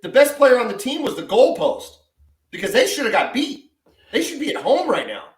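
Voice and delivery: a hint of Carly Lloyd's scorn, laughing at the end; mildly mocking quote; quoting Carly Lloyd. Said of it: "Carly Lloyd said".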